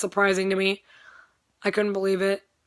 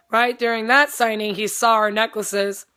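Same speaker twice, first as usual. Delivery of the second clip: ordinary delivery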